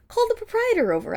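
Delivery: a haughty high-pitched dare to call the owner; defiant challenge. Acted. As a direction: throw the challenge in his face.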